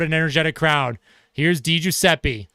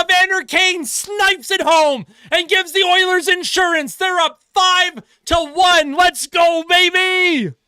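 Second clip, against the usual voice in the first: goal call; 11 semitones higher, slower than usual. Real, not acted. Commentator calls Evander Kane's insurance goal, real excited shout. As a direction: bellow the goal call, stretching the big words.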